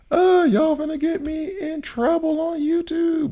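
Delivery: very high, slow squeaky voice; mock panic. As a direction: whine it up high and drawn out, mock alarmed. Teasing chat, mock-worried.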